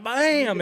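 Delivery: sharp high shouted bam, like a shotgun blast; imitated gunshot. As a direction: bark out the shot sound. Retelling partner's gunshot.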